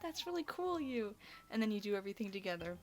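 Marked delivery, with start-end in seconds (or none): 0.0-1.1 put-on higher, eager boyish thanks; chirpy, sincere little thank-you; imitating sweet Edgar. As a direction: play him grateful and a bit awkward.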